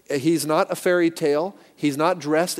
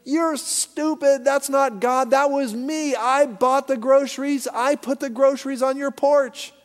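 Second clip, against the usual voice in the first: mocking atheist; 11 semitones higher, 5 dB louder than usual. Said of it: "he goes".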